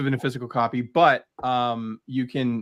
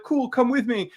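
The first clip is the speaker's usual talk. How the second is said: higher, breezy mock hero; casual astronaut; 10 semitones higher than usual. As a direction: toss it off like a carefree space hero. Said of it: "And he's like".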